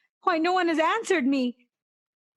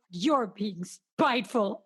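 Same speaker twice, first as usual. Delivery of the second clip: normal talking voice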